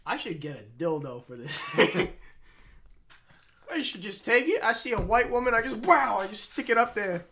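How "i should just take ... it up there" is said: mock stand-up voice. Bill Burr style joke.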